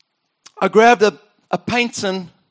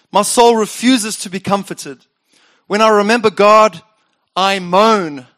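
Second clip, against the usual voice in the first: louder, solemn scripture reading; psalm reading; 3 dB louder than usual. Acted. Pastor reading Asaph's lament, solemn. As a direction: read the lament with weight.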